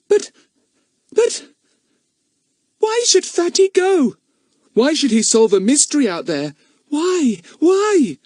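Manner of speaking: very high, stammering, upset cries; dismayed children. A narrator's reading. Children protest Fatty leaving, high and dismayed. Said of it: "began the four children".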